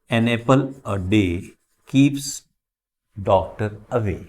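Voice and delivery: slow, spaced model sentence; slow reading. Shown as a demonstration. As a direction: read the proverb slowly for the class. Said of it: "reading proverb as model".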